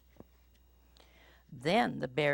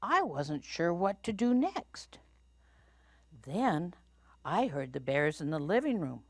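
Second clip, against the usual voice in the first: plain reading voice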